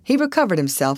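usual speaking voice

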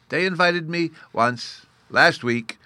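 put-on high, slow childlike solemnity; a small boy solemnly recalling an honour; very grave. A narrator's reading. Bruno tells of his one invitation, very grave. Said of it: "Bruno said very gravely".

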